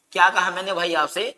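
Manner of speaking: ordinary delivery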